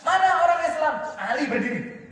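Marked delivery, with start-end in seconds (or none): 0.1-0.9 loud, high yell; challenging shout. Acted. Amr calling out the Muslims, a high shout. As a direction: yell it out as a dare. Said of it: "Amr bin Abi Wud teriak".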